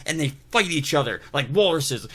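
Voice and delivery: normal talking voice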